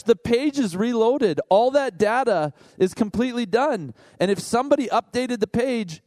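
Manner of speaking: ordinary delivery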